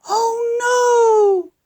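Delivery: high cry of dismay. Pretend. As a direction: wail it out, high and upset. Stuck in traffic, dismay.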